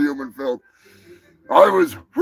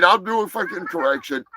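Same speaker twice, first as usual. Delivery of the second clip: usual speaking voice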